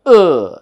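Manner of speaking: UH loud and long, falling in pitch; forceful "uh" without any R; upper stress. Shown as a demonstration. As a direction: punch the vowel "uh" and let it slide down. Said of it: "there is an upper stress".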